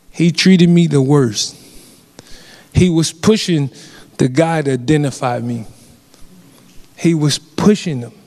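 usual speaking voice

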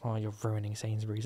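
low, very soft muttering; disapproving look voiced. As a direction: silent judgement under the breath. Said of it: "gives me a look of".

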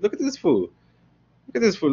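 high-pitched acted jeering; mocking friends. Friends laughing at him.

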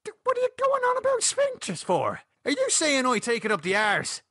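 very high, affronted questions; scandalised, voice shooting up; outraged disbelief. A narrator's reading. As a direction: splutter in high-pitched indignation. Offended by remark in quarrel.

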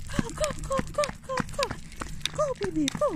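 very high, cheering the dog along; squeaky encouraging go go go; excited high cheering. Real, not acted. Owner urging her dog on during the walk, excited high cheering.

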